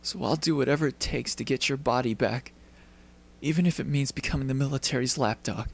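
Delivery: ordinary talk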